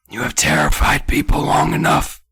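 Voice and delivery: loud, straining forced whisper; harsh stage whisper. Acted. Old man rebuking the supposed ghosts, harsh whisper. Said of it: "that whisper that destroys my vocal cords".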